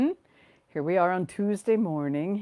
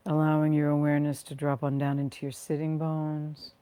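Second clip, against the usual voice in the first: guided meditation. Real, not acted. Leading listeners to rest and ground through their feet, guided meditation. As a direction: ease them into the body, phrase by unhurried phrase.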